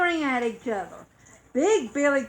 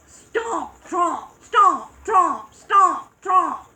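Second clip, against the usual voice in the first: loud, punchy, higher footstep words; heavy stomping steps; 4 semitones higher, 6 dB louder, slower than usual. A narrator's reading. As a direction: beat out each step like a heavy goat. Big goat's heavy footsteps.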